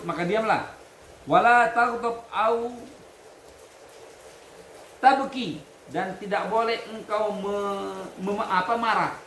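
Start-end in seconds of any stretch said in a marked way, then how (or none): 1.3-5.6 slow, loud, higher reading chant; Arabic recitation. Acted. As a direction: intone the Arabic text slowly for the class. Teacher reads Arabic text aloud.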